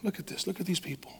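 quiet voice of the Spirit pointing out the crowd; whisper. Retold or imitated. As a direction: murmur it gently into someone's ear. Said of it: "He just whispered in my ear".

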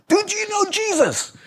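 high, excited blurt at a stranger; eager street preacher. Retold or imitated. Pastor Paul ambushing a passerby, high and eager. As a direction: pop the question out high and eager.

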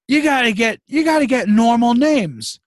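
a loud, high father telling pharmacists off; old dad lecturing. Retold or imitated. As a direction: lay down the law like a cranky old father. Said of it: "and I say".

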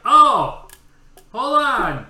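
loud, high holler; unwitting yell. Retold or imitated. Headphone wearer to stewardess, unwitting yell. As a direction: shout as if deafened by headphones. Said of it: "I didn't realize I was yelling".

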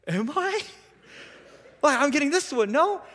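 high, squeaky mock-innocent teen asking mom; sheepish teen's high rising question; feigning innocence. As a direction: play dumb like a cheeky teenager, pitch shooting up.

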